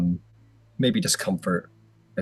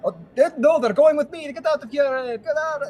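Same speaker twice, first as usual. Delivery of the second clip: high, loud squabbling gnome; comic high-pitched territorial yelling; 4 dB louder than usual. Acted. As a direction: fight over passengers in a high gnome voice. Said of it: "yelling at each other".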